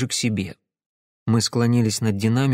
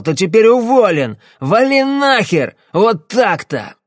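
menacing shout; 9 semitones higher, 6 dB louder than usual. Acted. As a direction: blow up at him, threatening. Drunk client raging at fixer.

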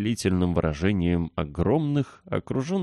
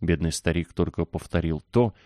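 ordinary delivery

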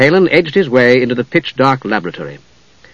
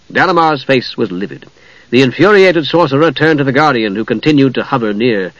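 ordinary delivery, nothing marked

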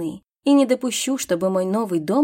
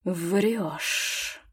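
low, soft dragon hiss; 4 semitones lower, 6 dB quieter than usual. A narrator's reading. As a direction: hiss the accusation. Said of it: "прошипел дракон". (низко прошипеть)

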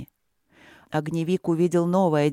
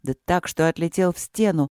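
ordinary delivery, nothing marked

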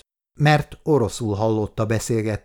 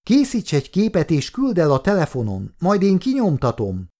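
Valeria advising; 8 semitones higher, 3 dB louder than usual. A narrator's reading. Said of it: "tanácsolta Valeria".

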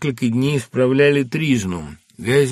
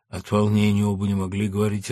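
usual speaking voice

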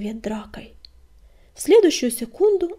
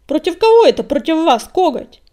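scornful snort, much higher and louder; 6 semitones higher, 10 dB louder than usual. A narrator's reading. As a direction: spit it out with contempt. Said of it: "фыркнул Метеор".

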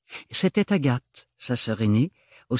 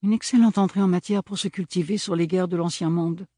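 ordinary talk

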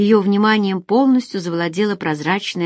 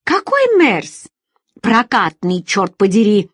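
exasperated; 3 semitones higher, 5 dB louder than usual. Acted. Feride and Alex quarrel over which Merc, exasperated. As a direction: jump up in pitch, then curse with force. Acted quarrel at the rental desk.

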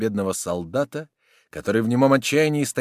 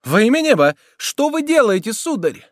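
high, loud appeal; alarmed protest; 13 semitones higher, 9 dB louder than usual. A narrator's reading. Woman confronting the soldier, alarmed protest. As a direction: cry out in alarm, pitched well up.

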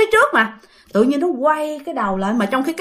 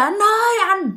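calling out loud; 7 semitones higher than usual. Retold or imitated. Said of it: "bạn kêu to".

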